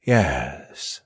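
slow, low snake reply; low, deliberate answer. A narrator's reading. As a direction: draw out the word as a snake. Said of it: "said Malachi slowly".

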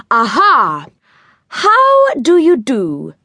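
slow, high, courtly greeting; all polite. A narrator's reading. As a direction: put on your best manners. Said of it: "going all polite".

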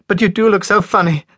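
high, loud, giggling voice; laughing helplessly. A narrator's reading. Lilia teasing her waving family, laughing. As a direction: call it out through laughter. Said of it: "And laughing helplessly".

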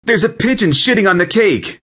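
high, panicked outcry; panicked cry. A narrator's reading. Panicked guest announces the pigeon on the cake, high alarmed cry. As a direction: raise the alarm at a high pitch.

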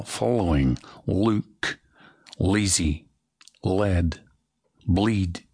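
following, look, lazy, led, bleed with a dark swallowed L. Shown as a demonstration. The coach drills dark L words. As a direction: swallow the L back in the throat. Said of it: "do that little tongue-swallowing dark L".

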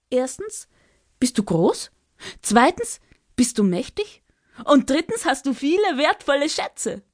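high, coaxing little bird voice; Ara spricht weiter. Acted. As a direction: keep flattering in a high bird voice. Ara lists reasons. (hohe, lockende Vogelstimme)

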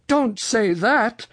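high, drawn-out dread; alarmed cry. A narrator's reading. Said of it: "cried Uncle Wiggily".

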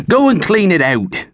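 bossy farmer ranting about the pigsty; angry shouting farmer. Acted. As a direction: tear a strip off the lazy servant. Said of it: "Thorgil shouted".

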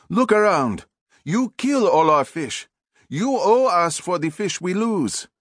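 put-on young nephew confronting the pilot, outraged and high; angry young fisherman. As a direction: demand payment, outraged. Nephew confronts crew boat.

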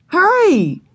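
put-on high, eager child's call; urgent cry. A narrator's reading. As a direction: hurry her friend along. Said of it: "cried Ramona".

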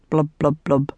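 low imitated blub-blub-blub of water; soggy washing noise. A narrator's reading. Earl's speech interrupted by washing, low soggy blubbing. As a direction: underwater burbling, voiced low. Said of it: "interrupted by soggy noises".

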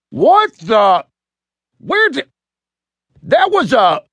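high-pitched broken-off exclamations; bewildered, acted high yelps; sputtering disgust. A narrator's reading. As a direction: sputter in shock, never finishing the thought. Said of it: "the sailor muttered as he tried".

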